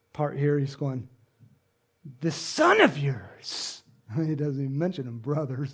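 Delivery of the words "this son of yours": slow, pointed accusation; accusing. Acted. Older son accuses his father about brother, accusing. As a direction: draw it out with disgust. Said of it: "he's making accusations about us".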